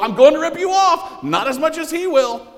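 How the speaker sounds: high, loud barker shouting fast; carnival barker shout. Retold or imitated. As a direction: yell it like a money changer at a fair. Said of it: "Maybe he's a carnival barker".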